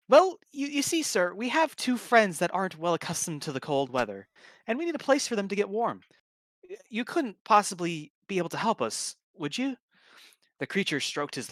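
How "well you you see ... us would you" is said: much higher, flustered and polite; startled, hurry to explain; surprised. Acted. Kirby caught off guard by the dwarf, flustered high pleading. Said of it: "Kirby was surprised at this".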